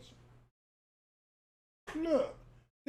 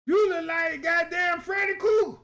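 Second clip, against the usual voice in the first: loud incredulous shout, higher; 6 semitones higher, 7 dB louder than usual. Real, not acted. Comic roasts a photo, shouting in disbelief.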